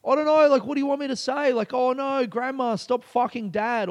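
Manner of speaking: high-pitched, exasperated retold outburst; upset and frustrated. Comedian fed up with the shitty prompts, frustrated. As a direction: re-enact being fed up, pitched well up. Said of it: "I was getting really upset and really frustrated".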